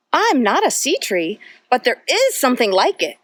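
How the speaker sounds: put-on lively storybook read-aloud for little kids; reading to children. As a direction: read it like a bedtime picture book, full of wonder. Reads picture book to children.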